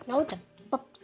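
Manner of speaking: usual speaking voice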